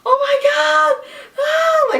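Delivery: very high, loud shriek of disbelief; high-pitched overwhelmed shriek, acted out; re-enacted scream. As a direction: scream it out as if reliving the shock. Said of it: "I was screaming".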